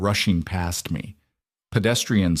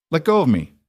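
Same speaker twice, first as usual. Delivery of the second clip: high, louder cry to break free; 7 semitones higher, 4 dB louder, faster than usual. Acted. Woman in guard's grip, crying out to be let go. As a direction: pull away and cry out, higher. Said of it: "I cried out".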